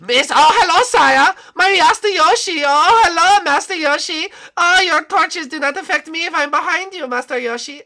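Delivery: very high, loud cartoon voice; shrill put-on animatronic killer; gleeful villain character. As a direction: greet your prey with mock delight. Plays the animatronic hunter.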